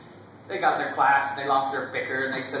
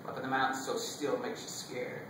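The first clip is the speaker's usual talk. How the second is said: soft, lowered storytelling voice; hushed, low recollection; hushed reverence; 6 semitones lower, 6 dB quieter than usual. Acted. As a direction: drop low and quiet, as in still mountains. Recalls still, lonely mountains.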